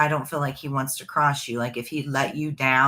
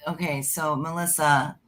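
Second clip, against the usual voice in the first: usual speaking voice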